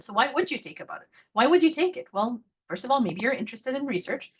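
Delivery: normal talking voice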